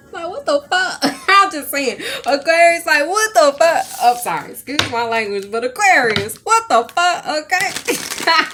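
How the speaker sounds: loud, high, laughing what the fuck; cracking up in disbelief. Real, not acted. Telling viewers about the possum, loud and laughing.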